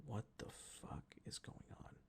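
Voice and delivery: very soft, whispery disbelief; hushed bewilderment. Retold or imitated. Waking up to strange crying, stunned whisper. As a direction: murmur it under your breath, baffled.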